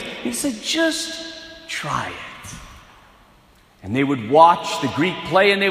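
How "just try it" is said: slow, soft, low invitation; gentle and calm. Retold or imitated. Alexander gently inviting conquered people, slow soft voice. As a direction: coax them gently, slowly. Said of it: "very gently, very calmly".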